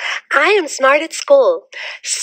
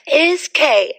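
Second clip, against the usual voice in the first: ordinary talk, nothing marked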